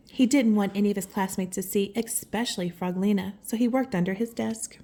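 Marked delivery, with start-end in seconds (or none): none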